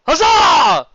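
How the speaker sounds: loud cheer; hearty cheer. A narrator's reading. Tavern crowd cheering the barmaid's idea, loud. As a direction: cheer it out big. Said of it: "met with a hearty cheer".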